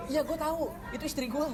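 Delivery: high, urgent acted retort; agitated taxi driver. Supir membalas protes suami. (membalas dengan nada tinggi)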